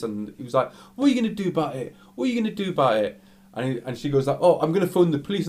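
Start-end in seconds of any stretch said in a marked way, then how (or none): 0.9-3.2 high, rapid-fire taunt, imitated; mock confrontational challenge. Customer squares up to the girl, acted goading. 4.4-5.5 high, quick warning, imitated; acted indignant threat. Girl threatens the abusive customer, acted indignant.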